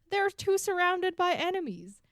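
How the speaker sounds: high-pitched mock griping; mock complaining. Host mimicking free folk griping about their posting, mock complaining. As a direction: push it way up, exaggerated grievance. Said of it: "they complained".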